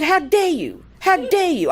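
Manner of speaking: high-pitched retold scolding; indignant lecture. Woman lecturing the gunman who wants her money, indignant. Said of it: "So I started giving him a lecture".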